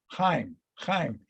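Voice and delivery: "Chaim" said twice with a throaty kh; "Chaim" as KHIME, guttural start, softly falling; correcting the pronunciation. Retold or imitated. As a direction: imitate someone patiently correcting: "Chaim", KHIME, each separate. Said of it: "And Weitzman says".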